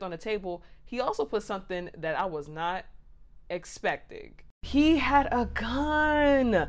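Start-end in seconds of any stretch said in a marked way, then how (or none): 4.6-6.7 high, loud, stretched-out announcement; dramatic reveal. Real, not acted. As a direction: draw out the shock reveal loudly. Big reveal after a suspense pause.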